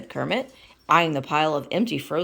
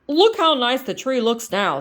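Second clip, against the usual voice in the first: Kermit voice; 7 semitones higher, 7 dB louder than usual. Acted.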